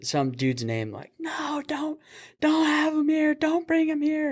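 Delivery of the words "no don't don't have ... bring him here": high, mock drunk wail; drunk girl yelling. Drunk patient yells for a man not to come, high mock wail. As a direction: play the drunk girl pleading, voice pitched way up. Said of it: "yelling somebody's name".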